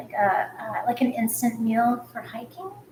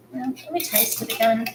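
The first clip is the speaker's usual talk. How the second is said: usual speaking voice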